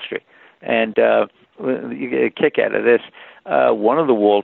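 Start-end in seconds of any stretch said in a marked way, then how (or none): none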